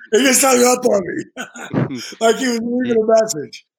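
laughing while telling; words broken by laughter. Real, not acted. Laughs retelling the hang-up.

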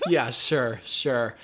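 low, dismissive concession; sceptical agreement. Real, not acted. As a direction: humour them without believing it. Sceptical reply to partner's claimed politeness.

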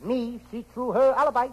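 high, pidgin detective voice; Charlie Chan. Acted. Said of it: "Me, Charlie Chan".

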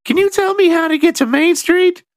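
very high, squeaky, timid voice; a huge man with a tiny squeak; squeaky big man. Retold or imitated. Big brooding man asking directions in a tiny squeak. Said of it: "And he's like".

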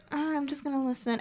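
quietly acting out holding back; retold private thought. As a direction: murmur it as if to yourself. Retells holding back at first.

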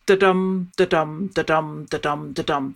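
chanted da-dum, da-dum, weak then strong beat; da-dum rhythm. Shown as a demonstration. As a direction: tap out the iambic beat with "da-dum", stress on "dum". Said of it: "and the feet go, uh, unstressed, stressed".

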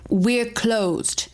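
put-on stern Norwegian accent, like an edict. A narrator's reading. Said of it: "she proclaims in her Norwegian accent".

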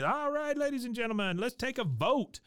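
high, showy DJ announcer call; DJ hype voice. Retold or imitated. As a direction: pump up the crowd like a party DJ. DJ Pauly Shore announcing to crowd.

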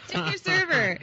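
high, laughing club-comic sign-off; laughing mock sign-off. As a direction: toss it out through laughter like a comic.

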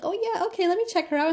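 lightly sing-song fake helpfulness; mock office clerk. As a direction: send up the careless office worker. Mocking the office staff.

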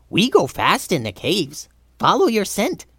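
high, choppy goblin voice; proud Goblin King. A narrator's reading. Steve telling Tulsi how they found her, high goblin. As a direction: explain it as an eager little goblin.

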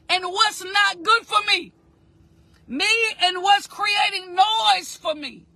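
crying out the words at full force; fervent preacher voice near tears; impassioned preaching. Acted. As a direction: preach it with a breaking, pleading shout.

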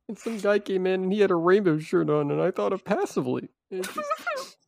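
high-pitched mock crying voice, climbing to a squeal; hammed-up tearful. Pizza worker telling co-host what reminds him of lost friends, mock tearful. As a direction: talk through put-on tears, losing control. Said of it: "some tears out of my eyes".

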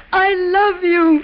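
slow, tearful I love you; choking back tears, slowly. Acted. Wife tearful as her husband signs off.

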